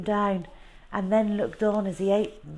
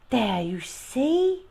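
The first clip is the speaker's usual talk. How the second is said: slow, higher soothing mother voice; mother coaxing; 6 semitones higher, 3 dB louder, much slower than usual. Acted. Mother comforting Bertie, higher and slow. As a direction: coax him gently.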